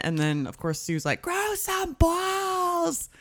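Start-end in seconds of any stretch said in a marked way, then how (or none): 1.2-3.1 high, drawn-out jeer; mock taunting holler. Retelling a castaway's taunt to a teammate, mock holler. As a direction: stretch each word into a jeering call.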